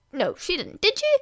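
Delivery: a lightly voiced copy of Inko; mimicking Inko. A narrator's reading. Student mimicking the guest Inko's voice, lightly. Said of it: "stop mimicking our honorable guest's voice".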